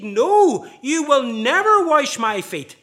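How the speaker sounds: loud, high, slow refusal stressing never; emphatic refusal. Retold or imitated. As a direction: put your foot down on never. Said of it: "No way is he going to let Jesus proceed".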